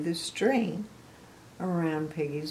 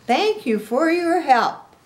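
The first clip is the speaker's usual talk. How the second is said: high, loud, slow thanks; grateful Piggy; 10 semitones higher, 9 dB louder, slower than usual. A narrator's reading. Piggy thanking the pelican, high grateful voice. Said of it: "Piggy says".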